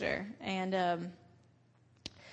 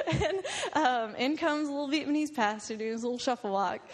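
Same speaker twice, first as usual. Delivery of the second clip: high-pitched laughter through the words; can barely get it out for laughing; laughing hard; 4 semitones higher than usual. Real, not acted. To the audience, recalling the pastor's entrance, laughing.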